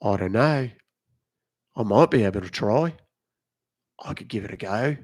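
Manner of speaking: put-on Australian accent with broad vowels; Aussie accent. Shown as a demonstration. Streamer attempts Aussie at a viewer's request, Australian accent. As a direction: try out an Aussie voice. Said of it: "Can you do Aussie?".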